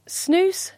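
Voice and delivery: "snus" with long oo and drawn-out s, rising; trying out snus. Shown as a demonstration. Reporter tries the confusing names, long-oo "snus". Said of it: "meant to be pronounced snus".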